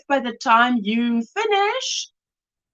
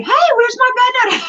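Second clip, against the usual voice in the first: high, loud alarmed call; surprised outcry; 12 semitones higher, 4 dB louder than usual. Real, not acted. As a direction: cry out as if something is missing. Noticing the banner is missing.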